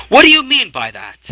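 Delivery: high, loud demanding question; indignant high lord; challenging lord. Acted. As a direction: snap the question at him.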